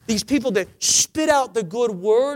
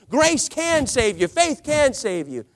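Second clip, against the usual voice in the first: ordinary delivery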